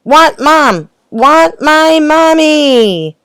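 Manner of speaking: put-on high, loud, slow drawn-out baby wail; toddler wailing. A narrator's reading. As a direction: wail for mommy like an upset toddler. Baby bellowing for her mother.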